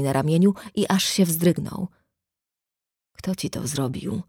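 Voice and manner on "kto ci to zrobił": hushed, altered voice; voice not his own. A narrator's reading. Shocked brother asks his sister who, in a soft, strange voice. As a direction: ask it softly, as if the voice has gone. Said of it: "zapytał nieswoim głosem".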